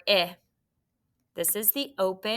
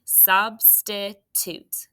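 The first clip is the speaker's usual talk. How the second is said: drawn-out syllable by syllable; teacher modeling a word slowly; slow demo word; much slower than usual. Shown as a demonstration. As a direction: stretch it slowly so kids hear each part. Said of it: "Good work".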